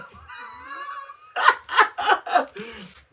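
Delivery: big high cackle; high shrieking laugh. Real, not acted. Laughing at own sassy voice, high and loud.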